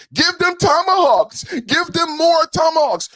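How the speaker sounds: high, loud whiny mocking voice; mock her eager call for weapons; mocking Clinton. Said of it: "What did Clinton say in Munich?".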